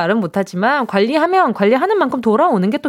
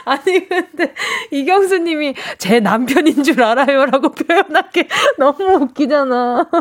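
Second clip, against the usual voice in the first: laughing while talking; 2 semitones higher than usual. Real, not acted. Cracking up over listener's text.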